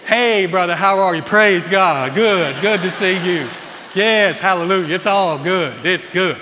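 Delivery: loud, overly cheery churchgoer voice; fake pious greeting. Husband mocking himself greeting the church after a fight, loud fake cheer. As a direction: switch on the fake Sunday smile, booming. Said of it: "we're just like".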